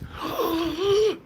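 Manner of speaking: sharp shocked intake of breath; gasp. Acted. Mock shock at tax letter.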